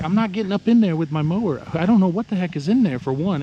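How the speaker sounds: high, loud protest, pushing back hard; replaying his refusal to mow into the woods, loud and high; retold indignant refusal.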